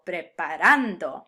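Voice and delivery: preparando with a slow, loud "RAN"; preparando, drawn out on its penultimate syllable; second-to-last stress. Shown as a demonstration. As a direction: lean hard on the "an" in preparando. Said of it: "emphasis on the second to last syllable".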